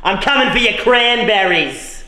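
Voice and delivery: slow menacing Cockney voice; Cockney villain threat; cockney accent. Acted. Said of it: "You guys sound like you're doing a Cockney impression".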